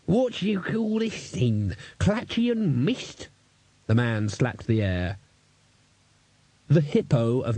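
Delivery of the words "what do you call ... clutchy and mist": put-on ranting old man.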